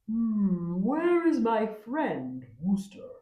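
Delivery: a searching leopard calling for his friend; leopard's question. A narrator's reading. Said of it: "he asked".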